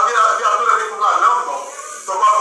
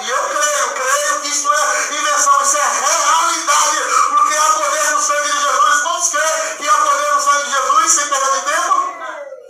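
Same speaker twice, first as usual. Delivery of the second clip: fervent preaching; 5 semitones higher than usual. Acted. Preaching at full force.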